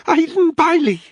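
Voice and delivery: high, loud panicked cry; terrified blurt. Acted. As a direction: blurt the name in terror. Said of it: "the driver stammered".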